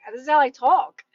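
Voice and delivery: a touch of laughing pride; proud loud declaration. Retold or imitated. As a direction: say it big and proud of being loud. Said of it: "I screamed".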